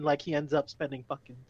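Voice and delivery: usual speaking voice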